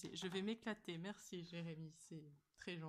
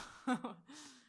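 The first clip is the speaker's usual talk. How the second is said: laugh; 5 semitones higher, 7 dB louder than usual. Real, not acted. Laughing at blindfolded friend.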